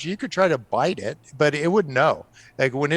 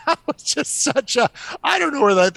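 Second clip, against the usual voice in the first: laughing; 11 semitones higher, 4 dB louder than usual. Real, not acted. Laughing at the CPR offer.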